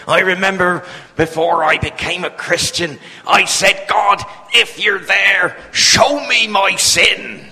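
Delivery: put-on West Country preacher accent, loud and slow; old West Country preacher crying out to God. West Country preacher recalls praying, loud country accent. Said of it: "very strong country accent like that".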